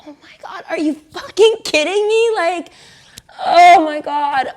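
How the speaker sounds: high, loud horrified cry; appalled high-pitched shout; shocked outburst. Retold or imitated. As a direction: yell it in disbelief. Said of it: "And I was like".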